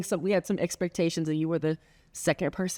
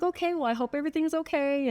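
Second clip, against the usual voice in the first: retold well-wishing; 9 semitones higher than usual.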